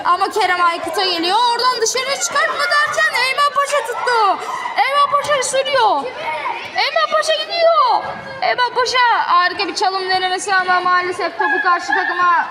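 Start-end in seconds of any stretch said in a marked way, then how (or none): 1.5-9.0 excited commentator. Acted. Dribble run, rising commentary.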